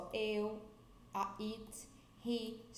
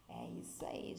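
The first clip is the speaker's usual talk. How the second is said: whisper; 7 dB quieter than usual. Real, not acted. Whispered aside to students.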